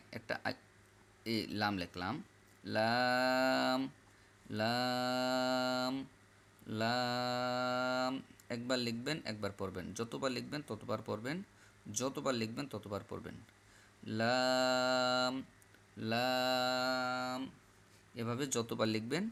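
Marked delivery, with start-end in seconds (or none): 2.6-8.1 লাম with the vowel held long on one level note; long, lips closing. Shown as a demonstration. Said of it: "লম্বাও হবে". 14.2-17.4 লাম with the vowel held long on one level note; long, lips closing. Shown as a demonstration. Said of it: "লম্বাও হবে".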